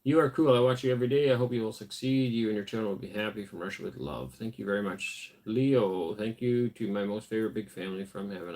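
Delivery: ordinary delivery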